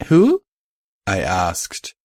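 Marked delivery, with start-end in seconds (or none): 0.1-0.4 startled. Acted. Sudden alarmed question.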